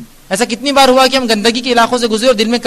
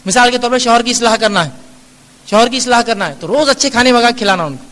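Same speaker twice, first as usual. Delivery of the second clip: ordinary delivery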